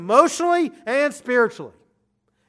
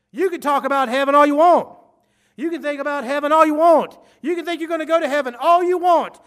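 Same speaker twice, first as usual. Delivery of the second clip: urgent warning; 6 semitones higher, 4 dB louder than usual. Acted. Preacher voicing Jesus' warning.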